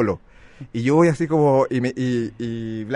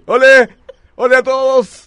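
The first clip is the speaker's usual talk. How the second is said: higher, much louder showy greeting to everyone; hammed-up posh hello; 12 semitones higher, 12 dB louder, slower than usual. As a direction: wave at the whole beach like a snob. Saludo pijo fingido. (saludo pijo exagerado a todos, agudo y muy fuerte)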